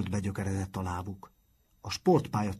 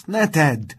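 higher and louder urgent yell; pleading shout; 7 semitones higher, 10 dB louder than usual. A narrator's reading. Matthias begging Uli not to jump, shouted. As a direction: yell it to stop a friend jumping. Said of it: "kiáltotta Matthias".

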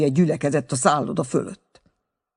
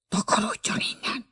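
voiceless whispered order to leave; hushed, breath-only dismissal; sharp whisper; 8 semitones higher, 4 dB quieter than usual. A narrator's reading. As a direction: whisper it urgently under your breath. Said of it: "suttogta Schulze".

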